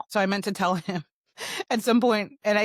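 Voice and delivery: ordinary talk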